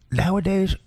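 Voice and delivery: ordinary delivery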